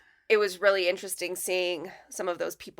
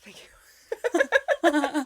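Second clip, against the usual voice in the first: laughing thanks; 7 semitones higher than usual. Retold or imitated. Retold awkward thanks.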